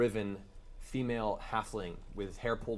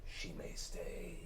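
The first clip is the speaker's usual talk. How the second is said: creepy whisper; 9 semitones higher, 7 dB quieter, slower than usual. A narrator's reading. Mind flayer speaks.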